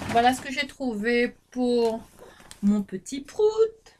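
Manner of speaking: slow, high, tender pet-name voice; affectionate cooing. Real, not acted. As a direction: coo it fondly about her little one. Talking fondly about her little son.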